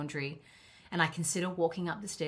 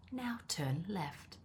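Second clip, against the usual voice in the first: slow, soft sat-nav whisper; a hushed mock navigation prompt; Siri voice; 9 dB quieter, slower than usual. Imitating Siri giving a direction, slow and hushed. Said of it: "Just like Siri will say".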